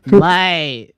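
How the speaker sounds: Australian "Mate" said loud and high, falling hard; mock Aussie mate. Shown as a demonstration. Caster showing how Australians say Mate, in a loud, high Aussie voice. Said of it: "It's all in the expression".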